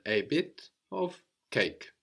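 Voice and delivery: a bit of cake said slowly, word by word; slow, careful. Shown as a demonstration. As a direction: spell each word out slowly. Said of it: "we focus on one bit at a time".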